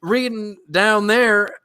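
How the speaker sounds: high-pitched put-on Southern accent; hammed-up country-bumpkin accent; mock country accent. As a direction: play a complaining simpleton with a country accent. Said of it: "that's almost a good accent".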